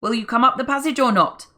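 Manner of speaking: loud furious demand; furious gunman shouting; angry shout. A narrator's reading. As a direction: bellow down the tunnel. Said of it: "shouted the voice angrily".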